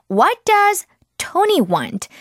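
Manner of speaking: very slow, clear model line; slow model sentence. Shown as a demonstration. Teacher modelling sentence slowly for children. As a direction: say it slowly so kids can repeat. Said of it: "model sentence for repetition".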